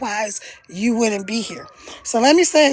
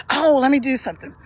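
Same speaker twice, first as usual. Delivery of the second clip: ordinary talk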